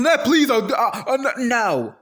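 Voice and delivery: high, loud whiny pleading; mock begging husband. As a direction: beg like a pathetic husband. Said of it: "he was like".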